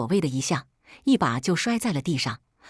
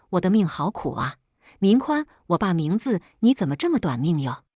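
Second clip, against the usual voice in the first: usual speaking voice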